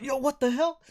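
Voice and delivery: high, loud, startled challenge; indignant surprise. Acted. Guard walking into a brawl, high indignant challenge. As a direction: call out in high-pitched disbelief.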